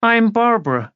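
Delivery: put-on high-pitched introduction; high, clear voice. A narrator's reading. Niece introducing herself to her aunt, high clear voice. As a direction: introduce yourself in an artificially high voice. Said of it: "high, clear voice that didn't sound at all like her".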